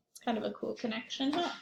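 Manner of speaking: plain reading voice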